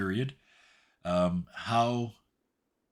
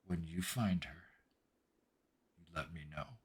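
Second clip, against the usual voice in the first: quiet parting order; 2 semitones lower, 8 dB quieter than usual. Acted. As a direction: let it sink quieter as a firm parting word. Game master voices her parting order.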